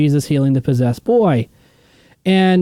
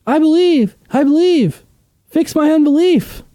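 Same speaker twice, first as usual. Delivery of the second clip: high, strained plea of a desperate father; pleading father; 12 semitones higher than usual. Retold or imitated. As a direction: beg like a father afraid for his son. Desperate father begging Jesus.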